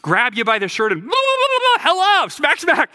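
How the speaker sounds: high, loud blah-blah and smacks; a playful high shout to jolt listeners; mock wake-up call. Shown as a demonstration. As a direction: shake them awake with silly high yelps. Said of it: "it's like a".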